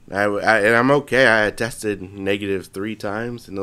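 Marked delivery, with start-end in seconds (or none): none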